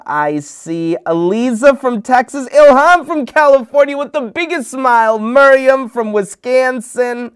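high, loud roll call; excited shout-outs. Real, not acted. Host greets viewers from many places, excited. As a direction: call out each name with big rising excitement.

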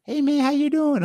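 put-on high, light boyish greeting; lightest voice. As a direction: squeak it out lightly like a kid. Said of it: "the lightest voice".

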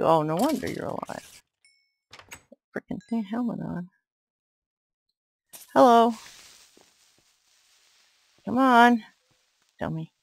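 usual speaking voice

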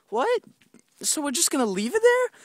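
high shocked what; squeaky disbelief. Retold or imitated. Shocked kid self.

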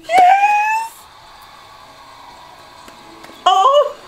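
high, loud cry of joy; joyful shout. Real, not acted. Vlogger screaming as her favorite wins.

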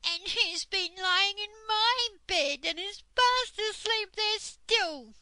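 very high, loud, squeaky cub; baby bear. A narrator's reading. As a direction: squeal it indignantly as a little bear. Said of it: "said the baby bear".

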